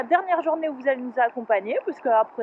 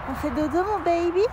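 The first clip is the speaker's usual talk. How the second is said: whispering over a crib; 3 semitones higher, 3 dB quieter than usual. Real, not acted. Cooing to a sleeping animal.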